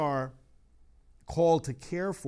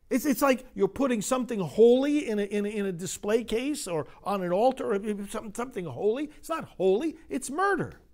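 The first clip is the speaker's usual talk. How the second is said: higher, louder, mounting pulpit indignation; preaching at full force; 9 semitones higher, 7 dB louder than usual. Acted. Priest preaching hard to his congregation, impassioned. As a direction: drive it home to the congregation, rising.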